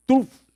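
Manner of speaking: sharp loud crack sound; knee crack noise. Retold or imitated. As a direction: smack out a bone-breaking sound. Knees broken on the cross.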